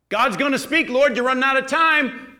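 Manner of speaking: shouted prayer, high and loud; impatient loud prayer. Retold or imitated. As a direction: cry out to God, demanding an answer now. Retold prayer demanding a sign.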